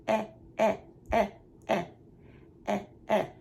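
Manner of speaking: "Eh" through a fake smile, repeated; short e sound. Shown as a demonstration. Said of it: "kinda doing a fake smile and you go".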